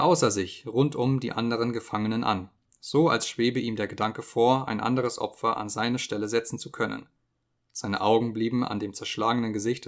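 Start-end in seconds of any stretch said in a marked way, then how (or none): none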